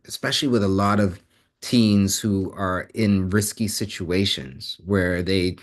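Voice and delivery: usual speaking voice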